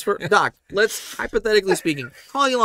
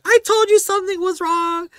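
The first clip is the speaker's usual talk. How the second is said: high mock nagging wife voice; high-pitched teasing wife impression. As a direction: squeak it like a know-it-all wife. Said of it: "Bridget's like".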